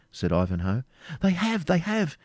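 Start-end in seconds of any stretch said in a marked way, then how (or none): 1.2-2.2 high, eager confirmation; exclaiming. A narrator's reading. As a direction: confirm it excitedly, pitched right up. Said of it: "exclaimed Rebecca".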